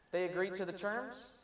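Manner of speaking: ordinary delivery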